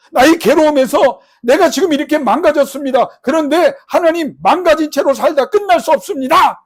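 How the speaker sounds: loud, high anguished plea; crying out. Acted. Jabez crying to God from his pain, loud anguished plea. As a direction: beg as if broken. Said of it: "라고 맨날 부르짖었어요".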